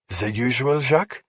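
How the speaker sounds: put-on high, rising French-accented question. A narrator's reading. Bartender asking a regular, French accent. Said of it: "that accent is fake".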